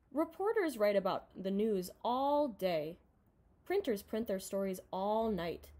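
usual speaking voice

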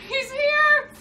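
high shrieking laugh; panicked laughing scream. Real, not acted. Zombie chasing, screaming laugh.

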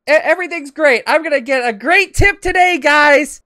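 high, loud, fake-happy mimicry; mock cheerful driver. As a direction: imitate her oblivious cheer, hammed up.

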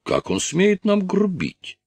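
very high, drawn-out indignation; put-on high voice, affronted; outraged crony. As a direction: stretch it out high, scandalized. Strus's friend is outraged by rudeness.

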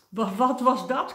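loud, high, stuttered cry of fright; startled stammering shriek; panicked screech. A narrator's reading. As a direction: screech it, stumbling on the first word. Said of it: "krijste hij".

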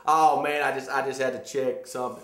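mock a caught phone-checker's excuse; mocking a viewer's lame excuse, lightly voiced. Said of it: "bull corny excuse of".

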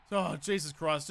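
usual speaking voice

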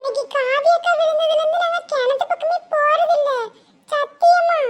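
put-on a kid insisting it's the truth; child voice. Child swears to grandmother. (சத்தியம் செய்யும் குழந்தைக் குரல்)

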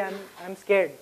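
usual speaking voice

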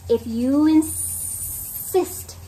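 slow stretched snake voice. Acted. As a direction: drag it out like a snake. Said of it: "said the snake".

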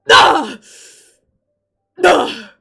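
short, full-volume "ah" screams; loud venting yell. Real, not acted. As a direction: yell out in relief. Venting after finishing.

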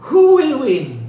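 amused at the absurd fight; laughing. Real, not acted.

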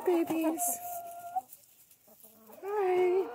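high, sing-song pet voice, slow and stretched. Real, not acted. Woman greeting her hens in high cooing baby talk. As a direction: coo at the chickens like babies.

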